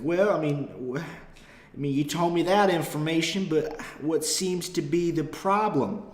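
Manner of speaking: hammed-up rural Southern accent; country cop accent. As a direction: drag out the question like a dim Southern cop. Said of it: "heavy, stupid country accents".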